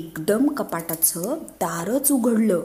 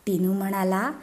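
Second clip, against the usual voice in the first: ordinary delivery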